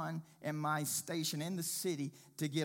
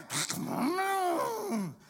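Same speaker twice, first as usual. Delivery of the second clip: squealing high laugh; 14 semitones higher, 7 dB louder than usual. Real, not acted. Man laughing at his own recklessness, high. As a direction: let a high laugh burst out.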